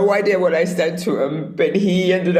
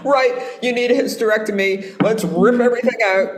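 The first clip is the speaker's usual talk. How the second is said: higher, brisk mock-doctor voice; 6 semitones higher than usual. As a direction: parody a blunt doctor. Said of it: "they go like".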